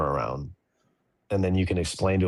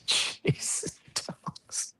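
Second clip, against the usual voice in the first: whispering; 8 dB quieter, much slower than usual. Real, not acted. Muttering at the dogs mid-call.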